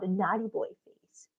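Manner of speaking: a soft whispered aside about the dog. Real, not acted. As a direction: whisper it close to the mic.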